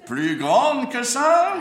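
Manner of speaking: very high, slow, loud insistence; exasperated ringmaster. Acted. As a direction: stretch it out in exasperation. Insisting the clown open wider.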